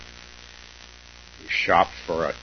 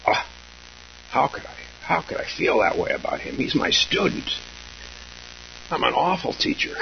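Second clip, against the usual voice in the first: self-critical teacher; 6 semitones higher than usual. Retold or imitated. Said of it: "And then we say".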